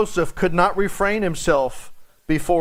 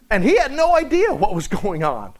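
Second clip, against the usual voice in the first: ordinary delivery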